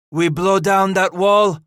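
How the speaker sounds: high, loud determined vow. A narrator's reading. Henri promises to break the stockade, vowing loudly. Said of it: "Henri vowed".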